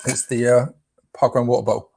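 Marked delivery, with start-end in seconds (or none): 1.5-1.9 "water bottle" with glottal stops for the t's; dropped t's. Real, not acted. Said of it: "Did it again".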